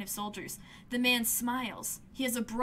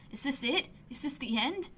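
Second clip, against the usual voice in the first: usual speaking voice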